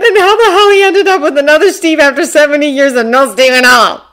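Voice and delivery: loud, high, incredulous narration; floored. A narrator's reading. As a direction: narrate as a mind reeling in disbelief. Narrator voices Bucky's reeling mind.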